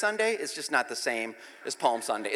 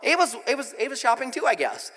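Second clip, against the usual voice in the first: mock surprise; 6 semitones higher, 6 dB louder than usual. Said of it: "I'm like".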